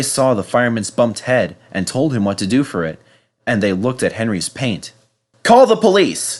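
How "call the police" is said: higher, loud angry demand; angry shout. Acted. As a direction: demand it furiously. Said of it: "they shouted angrily".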